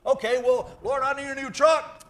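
high, loud demanding plea for a truck; loud mock prayer. Man loudly demanding a new truck from God. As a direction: holler it up to heaven like a spoiled kid. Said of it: "you receive not 'cause you ask not".

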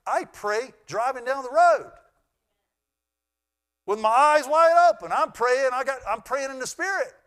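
high loud impassioned preaching; preacher at full force; fervent preaching shout. Real, not acted. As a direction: cry it out with fervour. Preacher exclaiming about prayer.

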